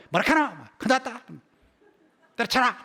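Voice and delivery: be a fed-up Gyeongsang local; Gyeongsang dialect. Acted. Preacher acting impatient locals giving up, Gyeongsang dialect. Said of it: "뭐라고 하느냐 이러면 답답해".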